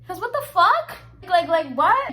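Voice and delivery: loud, high-pitched disbelief; exasperated, rising bewilderment; incredulous outburst. Real, not acted. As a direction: blurt it out, totally baffled. Baffled at the girl's choice.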